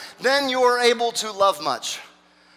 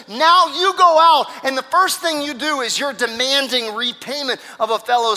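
loud, rising indignation; outraged; 3 semitones higher, 5 dB louder than usual. Acted. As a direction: confront the servant in preacher's outrage. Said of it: "we are outraged".

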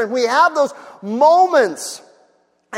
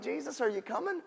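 hushed plea; 15 dB quieter than usual. Retold or imitated. Quiet pleading question.

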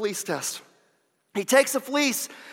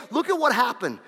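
usual speaking voice

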